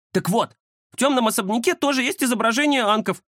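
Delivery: high-pitched cat character voice; cat Кузя, explaining. Acted. (высокий голос кота, объяснение)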